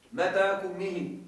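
متى كمه said slowly, word by word; slow repeat. Shown as a demonstration. Teacher repeating the Hudhayl phrase slowly. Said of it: "ومن كلامهم أخرجها متى كمه".

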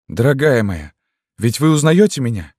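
high, pleading question; strange, alien voice. A narrator's reading. Man begs his beloved to recognise him, strained voice. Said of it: "голос его прозвучал странно, как чужой".

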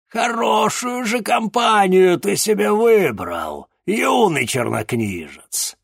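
slow, mocking villain voice; rumbling villain. A narrator's reading. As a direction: rumble it, gloating demon. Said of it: "пророкотал он".

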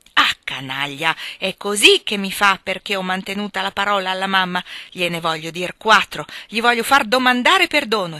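loud, forceful outrage; emphatic, building indignation; indignant exclamation. A narrator's reading. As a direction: vow loudly to settle the score. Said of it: "esclamò Joe".

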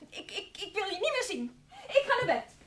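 storming off to bed, overwrought; rising upset outburst. Acted. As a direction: voice climbs high into a near-laughing cry. Ruzie, Hilde loopt weg. (opgewonden, overslaande stem)

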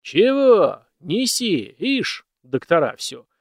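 loud, high booming jest; a master booming a jest at his housekeeper; booming joke. A narrator's reading. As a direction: boom out the joke. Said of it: "зычно пошутил промышленник".

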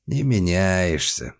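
slow, drawling delivery; drawn out. A narrator's reading. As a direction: stretch the words lazily. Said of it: "протянул Вадим Николаевич".

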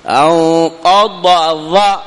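slow chanted أنقض, stopping at the ظ; careful separation. Shown as a demonstration. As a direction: sing أنقض slowly and cut before ظ. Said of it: "هكذا".

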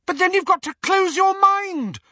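very high and loud, piercing; shrill urgent insistence; shrilly. A narrator's reading. Hermione urging Harry to close his mind, shrill. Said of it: "said Hermione shrilly".

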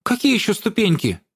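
high, baffled; ask, completely puzzled; puzzled question. A narrator's reading. Girl puzzled.

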